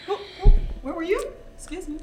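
high, quick, incredulous questioning; mock outrage. Real, not acted. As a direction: demand answers as if you were left out. Mock outrage at friends' secret trip.

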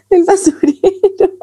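words squeezed out through laughter; slow, laughing through the words. Real, not acted. Laughing at the bin mishap.

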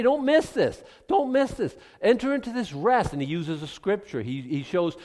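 usual speaking voice

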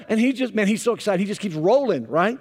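ordinary talk, nothing marked

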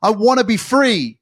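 high, loud desperate plea to be let out; trapped soul screaming. Pretend. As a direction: cry out like a soul locked inside. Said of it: "It's screaming at you".

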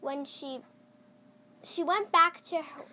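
ordinary delivery

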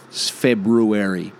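February pronounced Feb-ROO-ary, both Rs said; with the first R. Shown as a demonstration. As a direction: say February slowly, rolling out the R after the B. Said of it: "another R in it right after the B".

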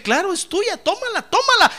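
high, rising, generous giving voice; a loving Lord handing over the blessing. Acted. The Lord to the believer, giving gladly.

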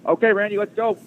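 high mock tycoon voice; hammed-up eager boss; imitating a brisk boss. Steinbrenner starting the interview with Randy, pitched high.

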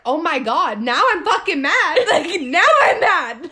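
half-laughing mock anger; mock outburst. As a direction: play the instant switch to mad. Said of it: "I was like".

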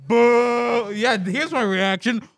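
loud, high boos; booing. Real, not acted. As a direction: boo the joke hard. Booing the chemistry joke.

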